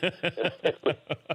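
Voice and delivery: real high burst of laughter. Real, not acted. Remembering Larry's two-and-one line, real laughter. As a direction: crack up at the memory. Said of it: "We all just kinda laughed about it".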